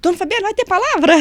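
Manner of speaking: high, loud and hopeful; eager asking. Retold or imitated. Inmates asking the guard for preaching, high eager question.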